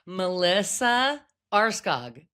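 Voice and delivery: slow, every syllable spelled out; over enunciating. Shown as a demonstration. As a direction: say the name very slowly and carefully. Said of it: "let me say it very slowly".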